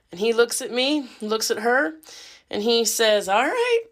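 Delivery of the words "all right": exasperated high all right. Retold or imitated. Doctor gives up.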